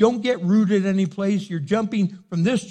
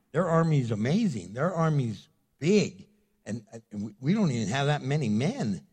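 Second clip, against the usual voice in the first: lower, hesitant, nervous voice; timid Gideon; 6 semitones lower than usual. Retold or imitated. Gideon backing away from God's call, lower and nervous. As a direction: stammer like a frightened man. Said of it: "And he's like".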